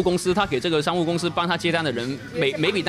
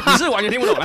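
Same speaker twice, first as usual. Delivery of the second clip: higher, louder Cantonese-accented Mandarin; laughing through old-style Guangpu; 8 semitones higher, 5 dB louder, faster than usual. Shown as a demonstration. (笑着说的广东口音普通话)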